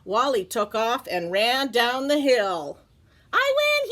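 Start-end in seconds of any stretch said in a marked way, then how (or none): 3.3-3.9 very high gleeful cry; triumphant. Acted. Wally at the bottom of the hill, gleeful. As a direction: crow about winning.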